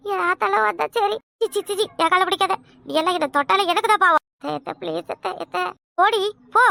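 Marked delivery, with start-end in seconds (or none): 4.4-5.6 softly begging, almost breaking; a faint, desperate last plea; softer desperate plea. Acted. Daughter-in-law pleads one last time with mother-in-law, soft and desperate. 6.0-6.6 higher, louder angry dismissal; angry shout. Acted. Mother-in-law throws daughter-in-law out, angry shout.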